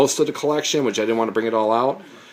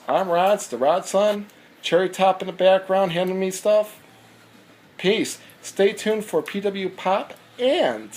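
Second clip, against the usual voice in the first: higher, pumped-up outro catchphrase; hyped sign-off; 8 semitones higher, slower than usual. Acted. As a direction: sign off like a wrestling announcer. Raised-pitch channel sign-off.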